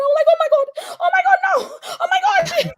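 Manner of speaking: high squealed scream through laughter; loud, very high, laughing squeal. Retold or imitated. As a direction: re-enact a joyful, shrieking freak-out. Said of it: "I was screaming".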